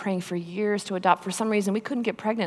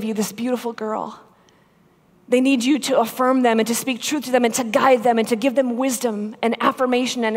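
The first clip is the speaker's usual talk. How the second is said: preach; 3 semitones higher than usual. Acted. Preaching to the men.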